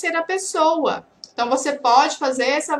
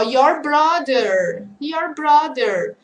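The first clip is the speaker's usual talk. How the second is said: slow model phrase; much slower than usual. Shown as a demonstration. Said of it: "outro exemplo".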